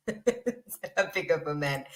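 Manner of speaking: slow words through soft, breaking laughter; laughing. Real, not acted. Amused by her own verdict on someone's taste in men, laughing.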